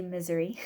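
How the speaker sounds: "Missouri" said like "misery"; variant pronunciation. Shown as a demonstration. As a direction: stress the first syllable of "Missouri", MIZ-er-ee. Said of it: "or as some say".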